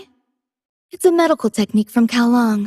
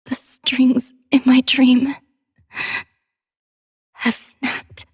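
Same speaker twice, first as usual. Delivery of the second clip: slow, halting words broken by breaths; pained, faltering; 2 semitones higher, slower than usual. Acted. Defeated performer whose dream strings have snapped, pained and faltering. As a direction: let it sink to a weak, breathy finish.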